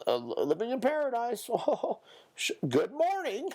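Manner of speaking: very high, chirpy mock voice with laughs; mocking basic greeter. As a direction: parody a cheesy small-talker.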